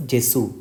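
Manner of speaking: "Jesu" as JEH-zoo, with soft g; Italian pronunciation. Shown as a demonstration. Teacher answering a try-this-word exercise, "Jesu" as JEH-zoo. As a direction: start "Jesu" with a j sound, end on oo. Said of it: "this word is pronounced as".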